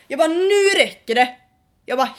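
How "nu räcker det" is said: slow, loud, fed-up warning; furious slow warning; angry. Retold or imitated. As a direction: lay down the law, slowly.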